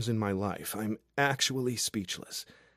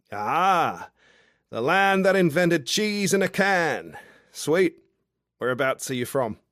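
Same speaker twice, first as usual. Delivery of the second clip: loose, cheery Australian bloke, pitched up and loud; a tipsy outback charmer calling up to a window; drunk Aussie guy; 6 semitones higher, 8 dB louder than usual. Acted. Drunk Australian guy below her window, boisterous and cheeky.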